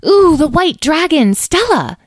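high, loud burst of excitement; excited exclamation. A narrator's reading. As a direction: exclaim it high, like a thrilled youngster. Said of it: "exclaimed Edwin".

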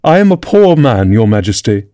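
plain reading voice

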